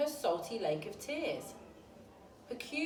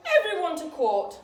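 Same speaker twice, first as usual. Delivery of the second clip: loud, higher queenly order; royal command; 8 semitones higher, 11 dB louder than usual. A narrator's reading. Queen ordering everyone to court, loud.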